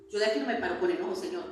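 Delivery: ordinary delivery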